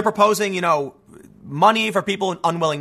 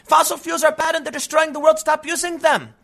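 high-pitched mocking impersonation; put-on high activist voice; mock Greta Thunberg; 9 semitones higher than usual. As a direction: mimic an activist on a high, droning note. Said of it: "I know what she's really saying".

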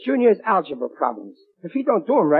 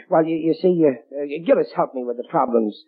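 ordinary talk, nothing marked

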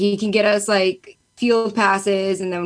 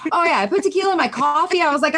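ordinary talk

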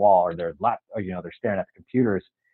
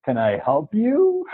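slow and much higher, casual "Can I help you?"; mock nonchalant; 11 semitones higher, slower than usual. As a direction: act cool, as if nothing's odd. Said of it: "nonchalantly just kinda says".

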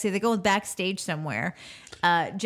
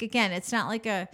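usual speaking voice

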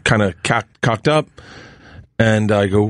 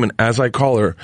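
usual speaking voice

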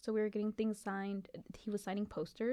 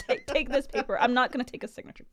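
loud, higher, playful ordering through laughter; mock pushy demand; 4 semitones higher, 10 dB louder than usual. Fan forcing paper on an artist, mock-bossy and loud. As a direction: push it on them loudly, half laughing.

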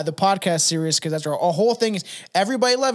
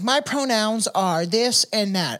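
mocking voice; 4 semitones higher, slower than usual. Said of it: "He's like".

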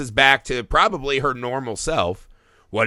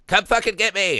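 mild mock yell, pitch a bit higher; half-hearted imitation of a man yelling down the stairs; retold yell; 6 semitones higher than usual. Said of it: "yell at me".

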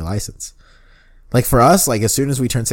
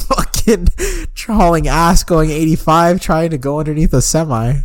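excited; 6 semitones higher than usual. Real, not acted. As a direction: hype up the scary merge. Excited retelling of highway merging.

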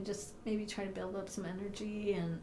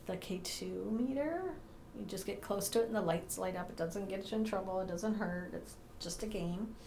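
normal talking voice

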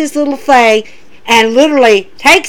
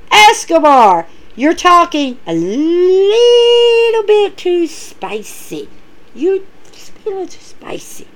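mock taunting voice; 6 semitones higher, slower than usual. Quoting Adonis taunting Escobar.

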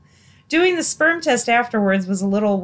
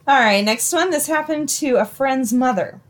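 ordinary talk

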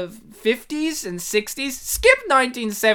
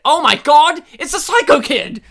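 loud, higher mock-shocked exclamation; astonished reveal; 7 semitones higher, 9 dB louder than usual. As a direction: announce the big twist in amazement.

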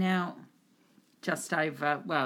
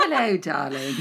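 femme fatale; 5 dB louder than usual. Acted. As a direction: purr it like a screen siren. Sultry greeting game.

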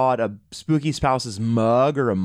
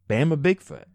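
put-on Southern accent. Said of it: "what kind of accent that was".

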